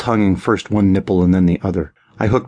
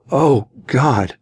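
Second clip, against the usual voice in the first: a pleasured groan lifted higher; 5 semitones higher than usual. Acted. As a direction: moan it out, overwhelmed. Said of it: "Paul groaned".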